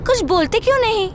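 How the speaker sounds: high-pitched, needling, speeding up at the end; a young woman mocking the silent men; taunting young woman. Aarushi taunts the strangers to make them speak, high and mocking.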